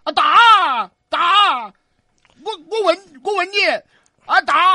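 loud, high attack shout; battle cry. A narrator's reading. Big ghost steps out to fight, shouting. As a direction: yell as you charge in.

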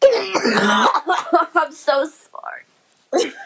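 slow, coughing laugh-cry through the words; real coughing laughter, barely words; gagging laugh. Real, not acted. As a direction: choke it out between coughs. Gagging after a rotten-egg bean.

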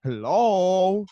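high, drawn-out cutesy greeting; mock a gushing sweetheart; imitando a Tito. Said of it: "Tito estaba hablando así". (saludo agudo y meloso, exagerado)

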